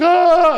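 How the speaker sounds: high, loud retold kids' scream; imitated scream. Retelling the kids in panic, high imitated scream. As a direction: let out a child's panicked cry.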